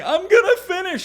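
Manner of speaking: high, loud crying vow. Retold or imitated. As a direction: sob it out. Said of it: "I'm bawling and crying".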